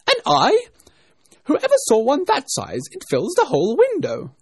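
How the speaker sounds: very high, loud disbelief; angry rabbit. A narrator's reading. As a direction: scoff at the servant in an outraged shout. Angry rabbit scolds the servant.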